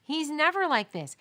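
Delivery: a shocked mother's cry; shocked mom. Retold or imitated. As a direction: mom startled her baby is crying. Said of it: "And Mom's like".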